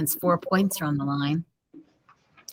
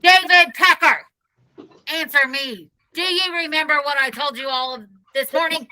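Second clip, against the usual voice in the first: yelled; 7 semitones higher than usual. Acted. Principal yelling at Dave through a bullhorn, high angry yell. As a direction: yell at the boy to answer. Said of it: "and yelled".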